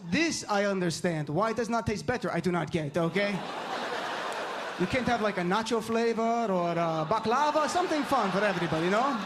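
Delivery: imitating dad in a thick Middle Eastern accent. Dad argues about communion's taste, Middle Eastern accent. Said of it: "my dad has an accent".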